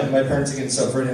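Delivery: ordinary talk, nothing marked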